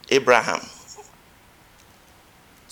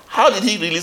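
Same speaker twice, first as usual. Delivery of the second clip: ordinary talk, nothing marked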